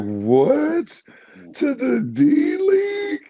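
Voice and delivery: high, slow cry of disbelief; mock outrage, voice cracking high; shocked disbelief. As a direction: act stunned at the bad news. Shocked at being sent down.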